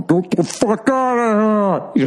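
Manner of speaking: high, drawn-out furious yell; mock bellow of an upset giant; angry giant impression. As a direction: roar it out like an enraged giant wrestler. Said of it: "Seeing a giant angry".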